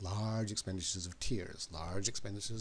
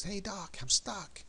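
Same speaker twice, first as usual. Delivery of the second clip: higher, pleading call from down in a hole; calling up for help; 10 semitones higher, slower than usual. Acted. Man stuck in a grave calls up to a doctor, high pleading call.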